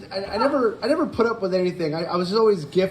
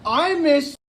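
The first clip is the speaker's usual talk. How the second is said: mock teacher; 10 semitones higher, much slower than usual. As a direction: overdo it like talking to someone deaf. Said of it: "she goes".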